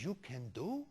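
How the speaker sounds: hushed, disbelieving Italian grandma; Italian grandmother accent. Retold or imitated. Grandmother first hears photographer can help, hushed Italian accent. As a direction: ask very softly in Italian-accented English, barely believing it. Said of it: "nice little old Italian lady".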